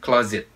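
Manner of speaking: "Closet" with a buzzing z in the middle. Shown as a demonstration. Teacher points learners to the Z, "Closet" as claw-zit. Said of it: "chữ S này là chữ Z".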